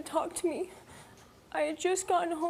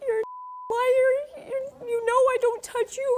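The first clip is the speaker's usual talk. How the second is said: high, loud mock yell at the child; imitating a furious dad yelling; retold screaming; 8 semitones higher, 4 dB louder, slower than usual. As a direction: shout it like an enraged father. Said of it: "starts screaming and yelling at her".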